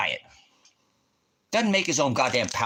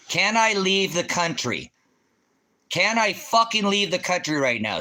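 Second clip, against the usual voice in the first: angry demand; 7 semitones higher than usual. Real, not acted. Streamer demanding an answer from the viewer. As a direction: press the question hard.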